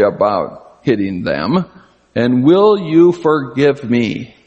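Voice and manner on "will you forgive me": slow, measured asking of forgiveness; deliberate apology. Shown as a demonstration. Grandfather modelling an apology for small children, slow and deliberate. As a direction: spell out the request slowly, word by word.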